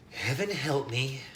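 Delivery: slow, resigned sigh of a line; tired mom at the end of her rope; mother sighing heaven help me; weary mother. A narrator's reading. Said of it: "his mother says".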